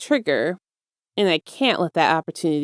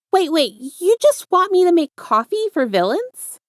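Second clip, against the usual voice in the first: high, louder rattled protest; shocked disbelief; 11 semitones higher, 5 dB louder than usual. A narrator's reading. Izuku reacting to the villain's job offer, high and loud in disbelief. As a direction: blurt it out in total disbelief.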